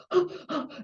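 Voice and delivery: sharp high gasp of panic, mimicking the professor; retold sudden frightened intake of breath; imitated panicked gasp. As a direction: gasp in as if panic is rising in a stuck lift. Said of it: "I could hear her breathing going".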